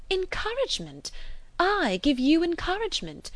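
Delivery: high-pitched, incredulous echo; indignant disbelief. A narrator's reading. Young woman scorns suitor's claim, indignant disbelief. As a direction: throw the word back, high and outraged.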